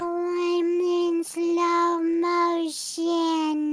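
extremely slow, low, softened drawl; slow-motion voice. Pretend. Player pretending slow walking slows speech, slow-motion voice. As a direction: stretch every word out like slowed-down tape. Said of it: "Just because you're walking slow doesn't mean that it affects your voice".

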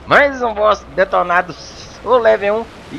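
loud, slow victory call; triumphant. Real, not acted. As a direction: announce the win proudly. Celebrating boss kill.

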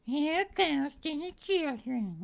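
lightly voiced eerie high taunt; slow, high spooky mockery; a hint of a ghoulish singsong; mock creepy voice. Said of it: "That was a little bit, different".

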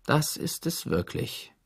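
a trace of disappointment. A narrator's reading. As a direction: say it as if sorry he lived. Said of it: "mit einer Spur von Enttäuschung in der Stimme".

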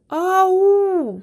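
Ау with А and У one after the other; Ау called, each sound heard in turn. Shown as a demonstration. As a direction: link the two sounds slowly. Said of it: "Один за одним".

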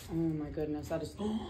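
ordinary talk

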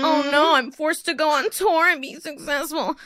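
high, whining mock-despair about forced success; mock whiny complaint. Comedian mocking his own tour in a whiny voice. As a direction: moan it like a hardship that is secretly a brag.

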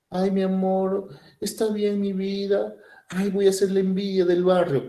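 voz aguda y lenta de hembra enamorada y melosa. Retold or imitated. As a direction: suspira como una novia ilusionada. (hembra encantada con su macho, voz aguda y melosa)

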